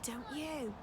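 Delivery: plain reading voice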